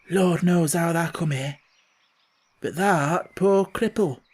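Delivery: put-on a touch of a trembling old gardener; shaky old voice. Ben answering Colin in a mildly shaky old voice. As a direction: answer in awe, voice a little unsteady. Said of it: "answer in a queer, shaky voice".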